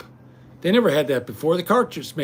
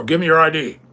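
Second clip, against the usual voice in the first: gruff cop demand; 7 dB louder than usual. Retold or imitated. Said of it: "them cops was at my door".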